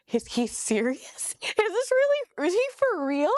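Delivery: rising, high-pitched disbelief; can't believe what you're hearing; incredulous. Retold or imitated. Churchgoer reacting inwardly to bishop's tights rules, incredulous.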